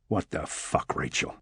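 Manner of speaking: quiet, low-pitched exasperation; low, muted indignation. A narrator's reading. Locked-in detective confronts Rachel, low indignation. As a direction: keep the anger down low and soft.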